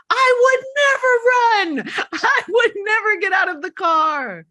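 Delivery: high, loud, drawn-out hollering at the screen; shouted protest. Retold or imitated. Host imitating teenagers yelling at the movie, high shouted protest. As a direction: holler it like an outraged moviegoer, hammed up.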